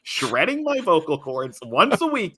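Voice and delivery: hammed-up long-suffering complaint; comic exasperation. Real, not acted. Comic complaint about being stuck.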